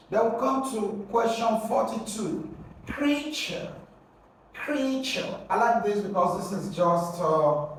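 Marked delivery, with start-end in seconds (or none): 2.9-5.4 high-pitched "Creature", first syllable stressed; "Creature" called out high as a model word. Shown as a demonstration. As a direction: hold up CREA high in "Creature". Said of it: "you're going to stress the first syllable".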